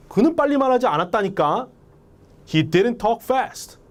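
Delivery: high-pitched acted protest; indignant denial. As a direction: insist on it with annoyance. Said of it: "감정만 여러분들이 주입시키면". (억울한 듯 화내며 항변)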